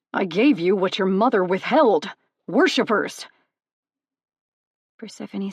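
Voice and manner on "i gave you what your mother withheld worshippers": loud, forceful shout; yelling. Acted. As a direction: drive the last word home at full volume. Hades keeps yelling.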